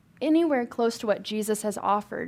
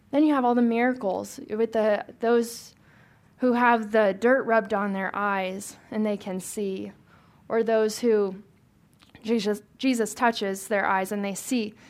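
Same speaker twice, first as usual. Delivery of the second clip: ordinary talk, nothing marked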